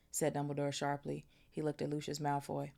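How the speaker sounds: ordinary delivery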